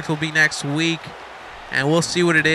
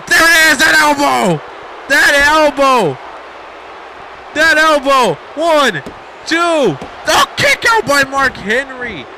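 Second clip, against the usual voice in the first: high, loud play-by-play at fever pitch; shouted climax call; 8 semitones higher, 3 dB louder, slower than usual. Real, not acted. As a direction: belt out the move and the count. Commentator calls move and count.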